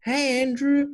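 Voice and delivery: a lightly voiced friendly caller greeting; Jane calling. Coworker Jane calling IT for help, high bright greeting. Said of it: "and she says".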